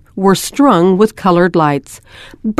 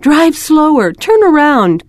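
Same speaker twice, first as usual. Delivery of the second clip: eager cry; 8 semitones higher than usual. A narrator's reading. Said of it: "they would cry".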